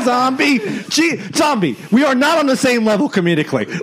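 high, indignant comic protest; exasperated outburst. Real, not acted. Indignant at being interrupted mid-impression.

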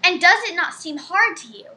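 ordinary delivery

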